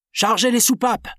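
captain shouting to the engineer; shouted order. Acted. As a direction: cry it over the hissing steam. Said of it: "s'écria Shandon".

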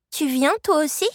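put-on high, anxious little-girl question; dreading the answer. A narrator's reading. Girl asking Soribel to come along, dreading the answer. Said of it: "redoutant déjà la réponse".

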